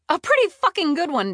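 high, loud, indignant; heated retort. A narrator's reading. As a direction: fire it back with outraged pride.

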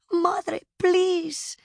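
put-on high, pleading child's whimper. A narrator's reading. Child begging departing mother to turn, whimpering. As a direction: plead pitifully as a little girl. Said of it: "she whimpered".

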